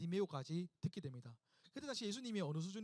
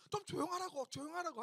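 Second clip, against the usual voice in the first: hushing crowd; 7 semitones higher than usual. Acted. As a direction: shush him insistently in a raised pitch. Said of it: "바디메오에게".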